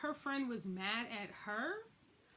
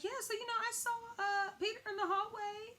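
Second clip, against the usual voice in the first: high, sheepish mock voice with a crack; high, uneasy voice telling the wife; awkward confession; 10 semitones higher than usual. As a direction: imitate the friend nervously admitting it. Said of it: "And she's like".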